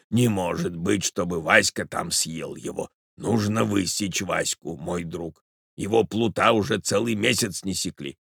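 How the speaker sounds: put-on doddering, murmured old man's concern; fussy old husband. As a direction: ramble gently, then fret over the wife.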